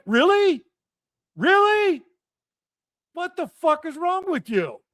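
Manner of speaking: high-pitched, incredulous outburst; incensed. Retold or imitated. Confronting education friends across the table over the booster, incensed. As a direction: re-enact an indignant confrontation, pitch climbing. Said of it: "I was so incensed".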